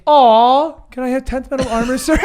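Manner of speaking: mock whiny request. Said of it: "You went".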